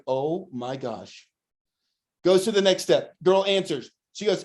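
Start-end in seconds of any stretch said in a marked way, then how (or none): 0.0-1.3 low, hushed, drawn-out amazement; stunned disbelief. Retold or imitated. Boss watching a new hire chat up a customer, stunned. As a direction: let it sink in slowly, under your breath.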